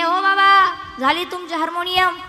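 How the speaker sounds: eager triumphant call; proud announcement. Retold or imitated. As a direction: announce the job done with pride. Man proudly announces the harmonium.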